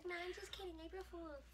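high, soft mocking imitation of a friend; mimicking friend. Woman teasing her friend with her words, high mocking imitation. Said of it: "I do not sound like that".

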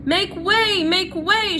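high, loud shout; Furlough shouting. A narrator's reading. Furlough clears a path through the mice, high loud shout. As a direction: bellow to clear the crowd. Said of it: "shouted Furlough".

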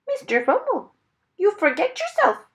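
high, loud parrot squawk of reproof; scolding parrot. A narrator's reading. Parrot reminding Mister Wumble to behave, high scolding voice. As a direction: scold like a parrot reciting its lesson.

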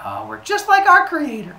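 high, loud, dopey-cheerful voice; hammed-up high group voice; silly humans. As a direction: chirp it as a proud simpleton. Humans answer gleefully.

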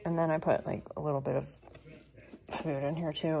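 ordinary talk, nothing marked

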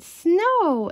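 high, loud, drawn-out exclamation; excited cry. A narrator's reading. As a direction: cry it out like a delighted cub. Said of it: "he cried".

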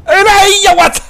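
loud, high burst of shock; startled shout. Real, not acted. Gamer hit by a grenade shouts in shock. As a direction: yelp as the blast hits.

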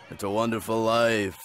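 normal talking voice

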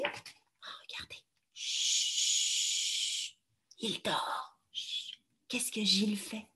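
soft hushed whisper. Shown as a demonstration. Teacher hushing children near sleeping fox, whisper. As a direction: hush them so the fox sleeps.

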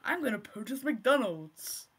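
slow, drawn-out American parody; mock American accent. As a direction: exaggerate the American accent, stretching the words. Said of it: "But American".

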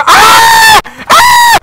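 two very high shrieks; loud high yelps on getting killed; startled scream. Real, not acted. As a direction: shriek twice, piercingly. Killed by sentry gun.

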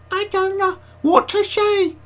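big high squeaky voice; slow, very high-pitched squeak; Celeste. Retold or imitated. Streamer doing a regular viewer's voice, high and squeaky. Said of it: "high, squeaky voice".

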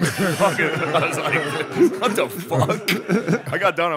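laughing disbelief, slowed down. Real, not acted. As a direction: laugh through the words. Laughing at the bad reviews.

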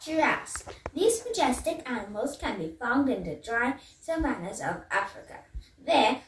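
nature documentary. Acted. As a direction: read facts like a wildlife narrator. Reads facts in lowered voice.

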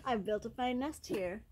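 ordinary delivery

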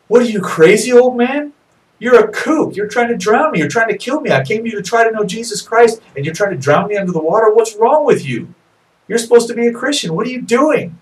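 high, loud, furious yelling; boy yelling at the preacher after near drowning, furious; really upset. Acted. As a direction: scream at the old man who nearly drowned you. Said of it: "And he was really upset".

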